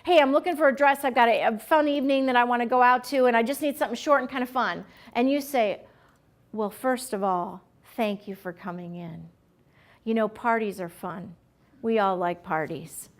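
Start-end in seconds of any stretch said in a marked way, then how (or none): 0.0-4.8 fast, chatty shopper's request; quick, run-on customer patter; rapid customer. Acted. Acting a customer in a hurry. 6.5-13.1 low, soft, dragged-out clerk reply; subdued and low, trailing away; sluggish clerk. Acted. Acting a mismatched sluggish clerk reply.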